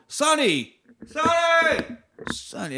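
high yelled name through a bullhorn; calling out Sonny. Retold or imitated. Cop on the bullhorn calling out to Sonny, mock yell. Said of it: "he's, like, going".

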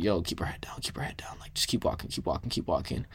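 urgent hushed whisper. Retold or imitated. To friends passing a rowdy crowd at night, whispering urgently. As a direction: keep it to a tense whisper, heads down. Sneaking past shouting crowd.

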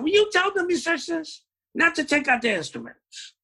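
imitating the boss. Club boss giving orders.

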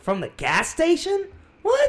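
disbelieving squeak at the idea; mock shock, voice leaping high; incredulous. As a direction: pitch jumps way up on what. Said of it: "I'm like".

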